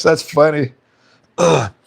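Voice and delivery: ordinary delivery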